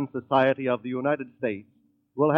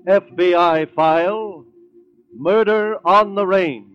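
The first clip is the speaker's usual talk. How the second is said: dramatic title announcement; 6 semitones higher, 4 dB louder, slower than usual. Acted.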